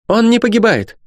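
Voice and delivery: very high, emphatic statement; loud, sharp denial. A narrator's reading. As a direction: declare it sharply, voice pitched far up. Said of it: "громко и резко перебила". (резко и высоко, утверждая)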